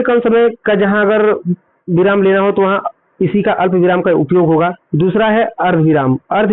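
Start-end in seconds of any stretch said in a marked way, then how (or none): none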